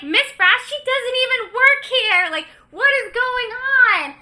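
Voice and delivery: high, loud mock indignation; outraged disbelief. Fired doctor storms out, loudly incredulous. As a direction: imitate a man protesting, voice shooting way up. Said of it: "He cannot believe it".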